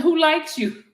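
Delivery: asking through a laugh. Retold or imitated. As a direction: giggle while reading it back.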